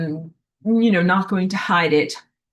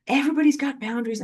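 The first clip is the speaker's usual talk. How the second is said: higher repeat; 7 semitones higher, faster than usual. Shown as a demonstration.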